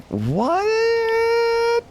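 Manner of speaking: hammed-up incredulous what; shocked what. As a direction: stretch the "What?" up high in disbelief. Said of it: "I was like".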